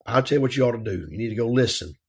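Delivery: ordinary delivery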